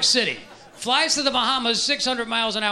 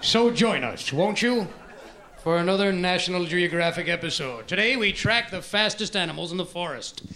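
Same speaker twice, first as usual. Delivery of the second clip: documentary narrator; 4 semitones lower than usual. Acted. Nature documentary parody.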